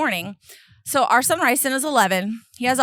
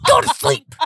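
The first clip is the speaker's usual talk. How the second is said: higher and louder, curt order; husband ordering daughter to bed, stern and louder; stern dad; 7 semitones higher, 5 dB louder than usual. Retold or imitated. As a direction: shut it down firmly. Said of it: "looked at her and said".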